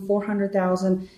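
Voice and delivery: ordinary talk, nothing marked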